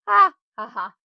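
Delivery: high, loud hammed-up "ha ha ha"; a self-mocking stagey laugh at her own boast.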